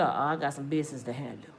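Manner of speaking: low, soft, fast brush-off; low man voice. Retold or imitated. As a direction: drop low and brush her off.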